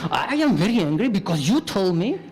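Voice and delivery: mock angry, blaming tone. Shown as a demonstration. Teacher showing students how to say it angry, mock blaming. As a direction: say it like you're upset at them. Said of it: "you need to say it angry".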